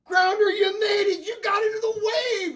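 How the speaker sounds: very high, loud celebration; squealing triumphant shout; jubilant dad. Acted. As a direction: whoop with a proud father's overjoyed disbelief. Dad celebrating son's acceptance letter.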